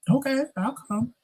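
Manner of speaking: high, soft okay; lightly playing the sister; sister agreeing. Sister agreeing to come, high and soft. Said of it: "she was like".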